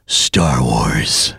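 very deep, low trailer rumble; ultra-low trailer announcer; modern movie trailer voice. Shown as a demonstration. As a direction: drop way down for a present-day trailer title. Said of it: "watch that and compare it to".

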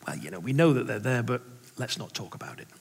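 low, softly fading aside; hushed dismissal. Acted. Church members avoiding an awkward topic, hushed dismissal. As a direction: drop the voice and let it trail away.

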